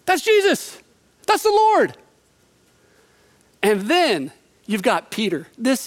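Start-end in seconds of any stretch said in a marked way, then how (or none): 0.0-2.0 John realizing. Retold or imitated. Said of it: "He's like".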